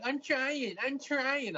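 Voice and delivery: high-pitched imitation of a flustered dog owner; mock frantic owner. Imitating the dog owner during the fight, frantic.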